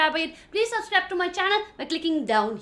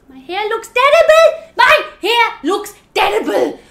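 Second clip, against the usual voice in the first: high, loud mock meltdown; hysterical wail; 5 semitones higher, 11 dB louder than usual. Vlogger melting down over losing hair, loud hammed-up wail. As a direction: cry it out in over-the-top despair.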